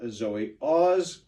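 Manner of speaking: ordinary talk, nothing marked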